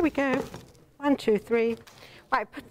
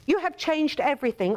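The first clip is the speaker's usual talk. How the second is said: ordinary talk, nothing marked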